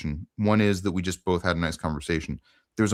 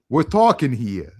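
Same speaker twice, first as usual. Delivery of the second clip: slow, louder, higher hammed-up New York accent; bad New York accent; 6 semitones higher, 6 dB louder, slower than usual. Host jokingly paraphrasing his guest from Brooklyn, exaggerated New York accent. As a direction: do your worst possible New York accent. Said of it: "my worst possible New York accent".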